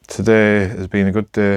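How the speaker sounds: normal talking voice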